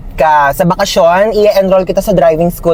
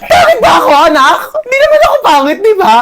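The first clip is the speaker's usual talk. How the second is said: hammed-up wail; 13 semitones higher, faster than usual. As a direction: wail dramatically, hurt. Mom crying over insult.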